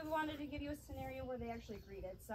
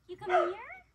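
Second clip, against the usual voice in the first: higher, louder coaxing question; coaxing call; 8 semitones higher, 11 dB louder, slower than usual. Real, not acted. Trainer coaxing a nervous dog closer, high and bright. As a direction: lift it up to invite the dog in.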